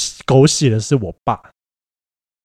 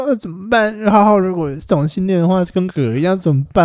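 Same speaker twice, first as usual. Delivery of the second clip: mock pitiful mom; 6 semitones higher than usual. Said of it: "我妈一开始就装可怜".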